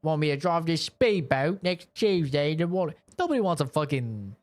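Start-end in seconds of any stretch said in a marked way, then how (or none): 0.0-3.0 put-on cockney-ish English accent, lightly done; mock English accent, cheeky. Playing Bond to casting, fake English accent. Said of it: "I can do a decent English accent".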